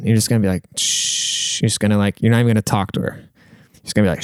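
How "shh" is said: long soft shush; quiet, drawn-out "shh"; hushing shh. Acted. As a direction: shush them to silence. Acting out hushing her.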